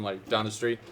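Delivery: usual speaking voice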